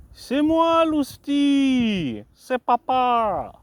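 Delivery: high, loud sing-song calling to the animal; excited calling out. Real, not acted. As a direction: call out high and loud to your pet.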